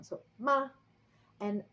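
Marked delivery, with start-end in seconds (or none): 0.4-0.7 "ma" loud, starting high and falling sharply; fourth tone. Shown as a demonstration. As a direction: throw "ma" sharply downward. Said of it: "goes down".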